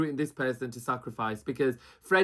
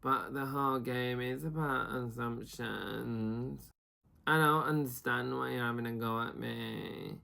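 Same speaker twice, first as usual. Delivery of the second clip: slow, softer, sulky voice; mock sulky Joe; 3 semitones lower, 6 dB quieter, slower than usual. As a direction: plead your innocence, sulking. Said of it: "naturally Joe's like".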